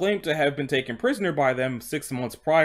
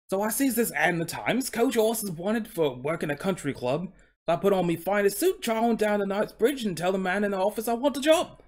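higher, eager Cockney storyteller; Cockney coach horse; 7 semitones higher than usual. Acted. Horse telling how he sought work, Cockney accent. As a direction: tell it keenly in put-on Cockney. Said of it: "he's speaking in a Cockney accent".

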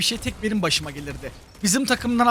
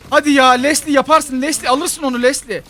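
loud, higher cheering-on; urging on; 6 semitones higher, 6 dB louder than usual. Real, not acted. Player urging teammate Leslie to take it, excited urging. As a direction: egg the teammate on loudly.

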